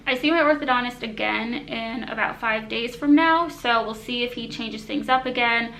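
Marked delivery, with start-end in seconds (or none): none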